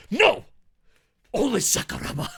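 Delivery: loud, high bark of refusal; mock-outraged shout. Fan refusing all martial artists but Sakuraba, mock-outraged shout. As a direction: shout it down with fanboy fervour. Mock rant answering his critics.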